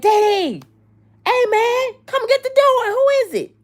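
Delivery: high, shouted call across a party; partygoer calling out. Retold or imitated.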